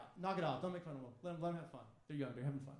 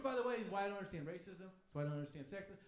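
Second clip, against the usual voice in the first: usual speaking voice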